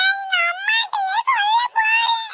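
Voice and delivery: high, slow wailing sob; child crying that she'll tell her mother; crying threat. Acted. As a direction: bawl it out like a hurt child. Child wails at teacher.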